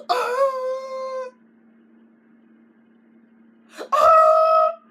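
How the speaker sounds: high, loud T-Rex scream; screamed roar. Shown as a demonstration. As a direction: let the scream rip. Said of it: "let out the scream like".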